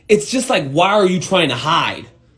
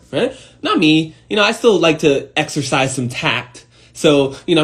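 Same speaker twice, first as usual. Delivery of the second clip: ordinary delivery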